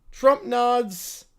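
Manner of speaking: ordinary talk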